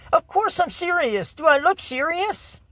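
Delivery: mock her raising her voice, high and offended; retold high-pitched indignant retort. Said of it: "raised her voice a little bit".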